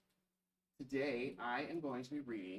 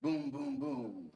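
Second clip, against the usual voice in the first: louder booming boom, boom; thunder boom; 3 dB louder, slower than usual. Acted. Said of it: "Mister Brown makes thunder".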